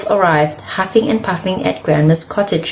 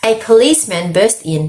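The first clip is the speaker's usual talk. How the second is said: ordinary delivery, nothing marked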